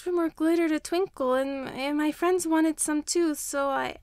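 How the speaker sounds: put-on high, hesitant child voice; a small child owning up, stumbling; little girl's voice. A narrator's reading. Child explaining to teacher.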